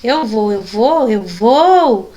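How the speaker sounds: slow "Eu vou", building to a drawn-out final "vou"; changing intonation. Shown as a demonstration. Teacher demonstrates intonation to the class, slow and insistent on "eu vou". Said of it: "aquela entonação da voz".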